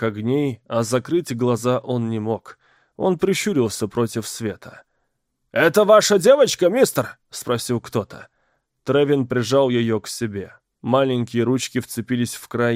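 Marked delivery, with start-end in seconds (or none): 5.5-7.2 surprised. Acted. Crowd voice calls out question.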